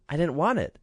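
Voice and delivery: put-on a pampered child shrugging; spoiled princess. A narrator's reading.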